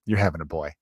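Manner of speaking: low, quiet retold pronouncement; matter-of-fact certainty. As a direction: state it low and softly, as settled fact. Retelling woman's sure prediction.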